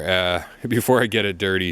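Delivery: ordinary talk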